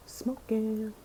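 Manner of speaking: conspiratorial whisper; hushed tattling aside. Real, not acted. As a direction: whisper it like telling a secret. Gossiping about partner.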